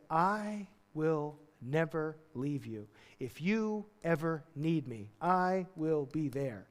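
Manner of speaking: slow, deliberate, tender vow; earnest promise. Acted. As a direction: pledge it slowly, word by word. Acts out a precious, solemn promise.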